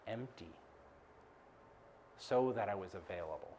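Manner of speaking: hushed, measured reflection; hushed, solemn. Real, not acted. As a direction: let it sink low and quiet, phrase by phrase. Solemn point closing the story.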